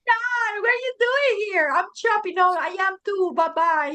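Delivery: high, bright quick chat; excited. Retold or imitated. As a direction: rattle it off, cheerful and high. Acting out a cheerful quick exchange.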